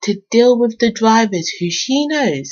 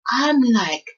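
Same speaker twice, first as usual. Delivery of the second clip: normal talking voice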